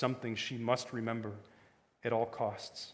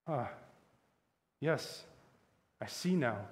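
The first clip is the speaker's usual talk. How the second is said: heavy, hopeless; slower than usual. A narrator's reading. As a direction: give in with a heavy heart. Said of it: "in a heavy, hopeless tone".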